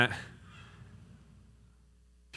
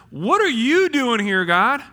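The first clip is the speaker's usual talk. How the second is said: loud, high, drawn-out challenge; mad at God; 9 semitones higher, 8 dB louder, slower than usual. Acted. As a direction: throw the question back indignantly. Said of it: "gotten a little mad at God".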